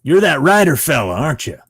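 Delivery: high, loud actor impression; Robert Patrick. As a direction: call out to a half-remembered writer. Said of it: "he goes".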